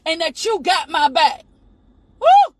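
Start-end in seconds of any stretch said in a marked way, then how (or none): 2.2-2.5 high, loud preacher's whoop; ecstatic whoop. Real, not acted. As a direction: let the Spirit burst out in a cry. Preacher overcome by the Spirit.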